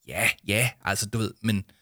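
usual speaking voice

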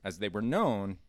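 ordinary talk

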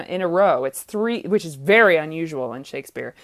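ordinary talk, nothing marked

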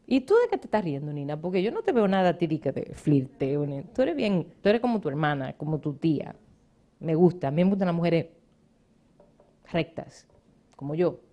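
usual speaking voice

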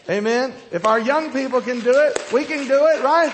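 very high, loud rallying cry; rallying preacher shout. Real, not acted. Preacher urging adults to follow young people, shouting. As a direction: shout it to rouse the whole crowd. Rallies the congregation.

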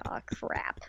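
unvoiced whisper, caught out. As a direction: hiss it like you're caught. Riko caught with manga.

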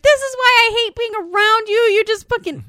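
high, loud, rushed angry tirade; retold furious rant; freaking out. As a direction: lash out in an acted outburst. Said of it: "Grace is just freaking the fuck out".

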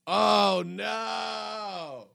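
mock dismay. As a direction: relive the sinking moment the cylinder quits. Engine loses a cylinder mid-ride.

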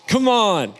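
high, loud cheer to the crowd; loud happy whoop at the photo; excited call. Real, not acted. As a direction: call the room in, hyped. Cheering the baby photo on screen.

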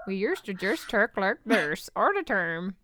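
slow, silly accent swallowing words in R's; exaggerated R accent. Shown as a demonstration. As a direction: turn every vowel into a heavy R, like a cartoon. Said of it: "used to speak with those R's".

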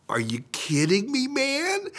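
disbelieving question climbing high at the end; acted disbelief, pitch shooting up on the last word; incredulous. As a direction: protest in rising disbelief. His incredulous reaction retold.

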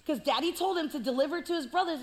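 ordinary talk, nothing marked

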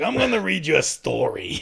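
lightly voiced detective Columbo; Columbo impression. Grandpa reading to his sick grandson, in a light Columbo voice. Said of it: "it's Columbo".

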